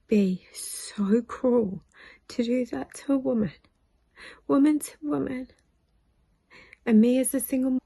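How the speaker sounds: tearful, straining voice on the edge of crying; voice breaking. Real, not acted. Recalling her manager's cruelty, voice cracking into tears. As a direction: choke up as the words get away from you. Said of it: "sorry, I, I had to stop because it really affected me".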